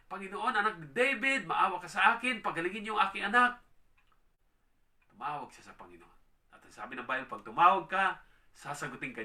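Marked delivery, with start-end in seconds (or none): 0.1-3.6 louder, higher plea for mercy; the woman begging the Lord for her child, loud and high; pleading cry. Acted. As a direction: beg the Lord to heal your child. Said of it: "sabi niya".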